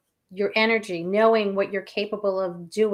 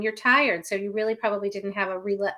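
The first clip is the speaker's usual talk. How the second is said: ordinary delivery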